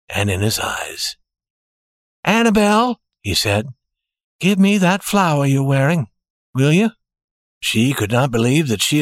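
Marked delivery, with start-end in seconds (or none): 2.2-3.0 higher, lightly acted address; lightly voiced fiancé. A narrator's reading. Man turning to his fiancée, lightly voiced. 4.4-6.9 slightly raised, level request; lightly voiced fiancé. A narrator's reading. Man asking his fiancée a favour, lightly voiced. Jimmy asks Annabelle for flower.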